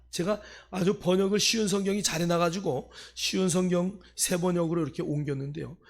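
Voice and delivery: ordinary talk, nothing marked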